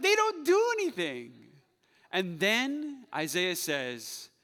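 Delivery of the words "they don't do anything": high, loud scornful voice; mocking scorn. Preacher mocking useless idols, high and loud. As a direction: sneer at idols that do nothing. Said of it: "They're like".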